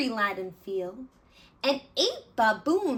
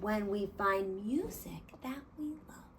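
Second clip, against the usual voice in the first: soft whisper; 7 semitones lower, 10 dB quieter than usual. Acted. Gerald's quiet conclusion.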